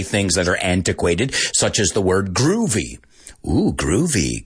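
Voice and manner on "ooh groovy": jokingly. Shown as a demonstration. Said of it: "we say it, like, jokingly".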